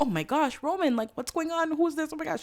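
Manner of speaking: high-pitched panicked babble; freaking out. Acted. Evie freaking out at Roman over an intruder, high panicked voice. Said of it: "Evie's freaking out".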